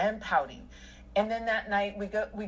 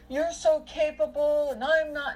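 higher and louder, self-pitying; mock whiny friend; 7 semitones higher, 5 dB louder than usual. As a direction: imitate her moping complaint. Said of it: "she was like".

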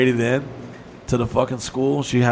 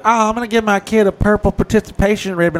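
whiny parent; 6 semitones higher, 4 dB louder than usual. Retold or imitated. Mocking parents giving ribbons.

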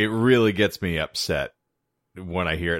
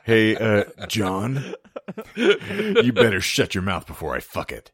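lightly voiced sleazy porn star; a hint of a menacing porn star; Brandon Saint Randy. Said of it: "Is that Brandon Saint Randy?".

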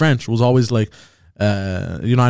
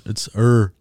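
normal talking voice

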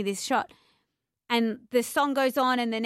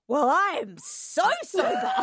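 higher, slow, gloating with a laugh; smug defiance; 10 semitones higher, slower than usual. Retold or imitated. Leaving the party, she gloats to herself after being yelled at. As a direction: rub it in, mock-triumphant. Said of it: "I was so mad".

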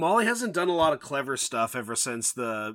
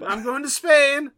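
high silly voice; 12 semitones higher, 8 dB louder, slower than usual. Acted. Joke voice.

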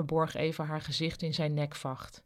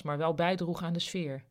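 plain reading voice

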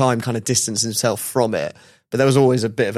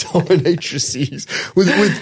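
laughing; 5 semitones higher, slower than usual. Real, not acted. Laughing at the word.

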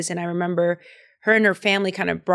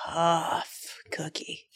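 whisper; 4 semitones lower, 11 dB quieter, much slower than usual. Acted. Teasing her friend as tough.

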